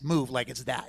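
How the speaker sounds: ordinary talk, nothing marked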